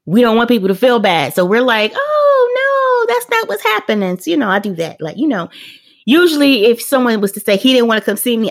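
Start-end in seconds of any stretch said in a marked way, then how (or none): 1.9-4.2 high sugary reassurance; high and sweet, smoothing it over. Retold or imitated.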